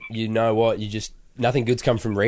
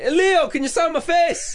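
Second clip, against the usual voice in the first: much higher, slower mock Scottish lady; a second go at a Scottish accent, pitched up high; slower than usual. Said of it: "Oh, Scottish".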